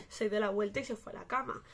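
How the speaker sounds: normal talking voice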